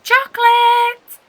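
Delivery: long high call, drawn out slowly; shout. A narrator's reading. Anna calling Chocolate to come play, drawn-out shout. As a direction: stretch the name out loud across the sand. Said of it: "shouts Anna Hibiscus".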